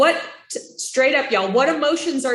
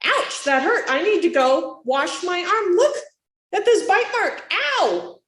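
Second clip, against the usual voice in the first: high pained parent setting a limit; honest hurt reaction; 8 semitones higher than usual. Shown as a demonstration. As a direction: show the toddler how much that hurt. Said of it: "You can react like".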